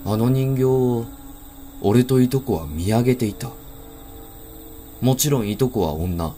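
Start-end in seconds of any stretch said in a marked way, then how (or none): none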